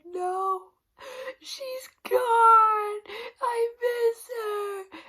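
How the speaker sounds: over-the-top mocking blubbering plea; mock bawling. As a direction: imitate him sobbing his heart out. Husband insisting to mom, crying.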